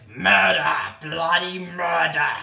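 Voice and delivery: loud, drawn-out frantic yell; yelling murder. Acted. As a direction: bellow it for help across the town. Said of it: "yelled the man from Ironbark".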